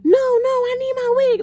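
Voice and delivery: put-on high, teary drunk whine; drunk whining. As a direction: plead high and weepy like a drunk friend. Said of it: "She like".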